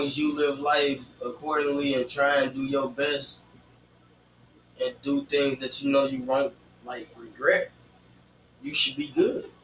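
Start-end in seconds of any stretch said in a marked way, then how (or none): none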